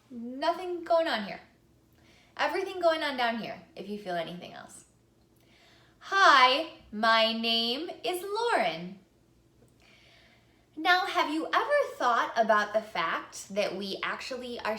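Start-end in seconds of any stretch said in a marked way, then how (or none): 6.0-9.0 loud, slow greeting; louder speech. Shown as a demonstration. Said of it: "let's try to speak that a little bit louder".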